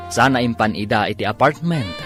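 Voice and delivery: ordinary talk, nothing marked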